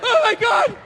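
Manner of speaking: high, strained, desperate dieter; whiny dieter. Retold or imitated. Starving dieter pleading before the photo shoot. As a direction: whine about the salad near tears.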